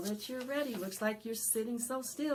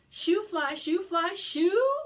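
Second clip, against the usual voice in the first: higher, louder chant; call-along shout; 12 semitones higher, 11 dB louder, slower than usual. Shown as a demonstration. Librarian leading kids in the shoo chant, loud. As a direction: belt it so the kids join. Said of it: "Can you say".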